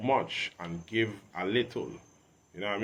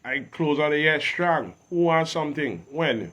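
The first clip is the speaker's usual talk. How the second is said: higher and louder, pumped up about his year; hyped boast; 4 semitones higher, 6 dB louder than usual. Real, not acted. Rapper hyping his year to the interviewer, loud excited boast. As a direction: brag it loud and proud.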